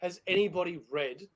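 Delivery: low, soft hush after yelling; bringing it down. Pretend. Host asking the audience after shouting, mock breakdown hush. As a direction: drop right down to address the crowd. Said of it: "breakdown section of the song where we bring it down".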